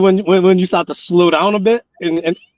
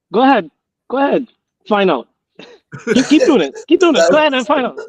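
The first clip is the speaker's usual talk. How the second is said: put-on higher, taunting stern dad daring the kid; menacing strict father; 8 semitones higher, faster than usual. Imitating his father taunting a disobedient son. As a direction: dare them to keep going like a hard father. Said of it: "my father's thing was is".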